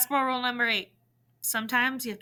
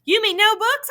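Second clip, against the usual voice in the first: higher and louder, bursting out; can't believe the luck; thrilled little brother cutting in; excited disbelief; 12 semitones higher, 9 dB louder than usual. A narrator's reading.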